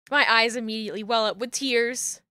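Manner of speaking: normal talking voice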